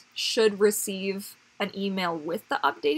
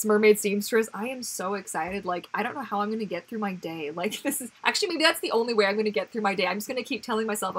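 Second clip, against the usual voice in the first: usual speaking voice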